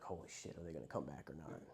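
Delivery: hushed, panicked whisper; frantic. Retold or imitated. Watching someone overdose, a frightened hushed whisper. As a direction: whisper it in fear over someone who overdosed. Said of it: "you're frantic".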